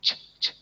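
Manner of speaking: "ch, ch" as quiet breathy bursts; what students should answer; unvoiced ch. Shown as a demonstration. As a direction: model "ch" with breath only. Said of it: "students say".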